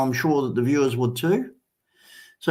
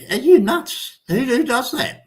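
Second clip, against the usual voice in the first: incredulous; 10 semitones higher, 4 dB louder than usual. Retold or imitated. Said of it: "They just go".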